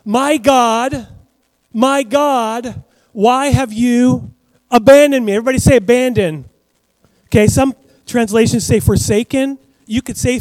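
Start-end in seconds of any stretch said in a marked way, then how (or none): 0.0-5.3 high, loud, slow cry of abandonment; loud anguished cry. Retold or imitated. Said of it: "which means". 5.3-6.4 call to repeat. Real, not acted. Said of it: "preacher calls congregation response".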